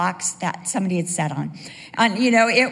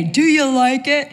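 mock-proud husband, higher and louder; 5 semitones higher, 8 dB louder than usual. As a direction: puff up like the husband taking credit. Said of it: "on Christmas Day".

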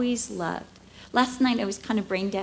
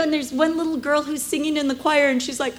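ordinary talk, nothing marked

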